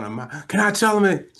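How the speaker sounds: high imitation of an excited wife; eager interjection. Wife begging to tell her husband, imitated eagerness. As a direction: butt in eagerly from the background. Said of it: "She's in the background going".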